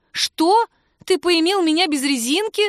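high, loud, incredulous outburst; shocked. A narrator's reading. Woman to lover who forgot protection, shocked. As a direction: confront him in high-pitched disbelief.

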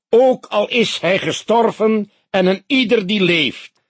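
high, loud pulpit intoning; theatrical sermon. A narrator's reading. Pastor reading scripture at the funeral, booming. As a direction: preach it with theatrical solemnity. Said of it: "theatraal gebaar".

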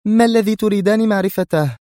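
very high, irate question; high-voiced angry challenge; sharp. A narrator's reading. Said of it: "وسألته في حدة".

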